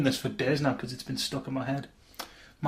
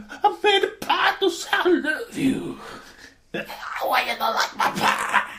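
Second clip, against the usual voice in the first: high, slow, laughing mock Russian plea; mock Russian breakdown; much slower than usual. Imitating the pie sketch for friends, a high slow mock Russian plea. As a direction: break down in a hammed-up Russian accent. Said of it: "break down going".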